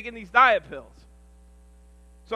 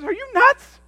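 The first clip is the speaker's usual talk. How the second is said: much higher, louder disbelief; incredulous mom; 8 dB louder, slower than usual. Retold or imitated. Mother scolding her son's caffeine cocktail, much higher and louder. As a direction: fling it at him. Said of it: "And she says".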